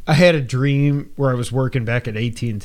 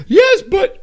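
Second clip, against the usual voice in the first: high, louder protest; mom voice; 7 dB louder than usual. Retold or imitated. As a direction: insist like the mother, pitched way up. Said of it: "And she's like".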